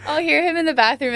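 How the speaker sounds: ordinary talk, nothing marked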